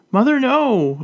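high squeal between laughs. A narrator's reading. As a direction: shriek it, laughing and squirming. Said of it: "Elizabeth squeals between laughs".

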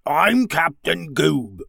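slow, drawn-out slimy alien; pompous alien captain, slow and grumbly; slimy, grumbly creature. A narrator's reading. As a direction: grumble it like a frog-faced villain. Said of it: "in a slimy, grumbly voice".

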